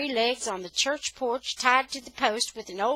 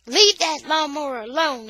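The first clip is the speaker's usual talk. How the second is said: loud, raised-pitch order; yelling; 6 semitones higher, 9 dB louder than usual. A narrator's reading. Boy catching up to the thieves, yelling. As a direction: holler a warning at them. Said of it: "I'm yelling".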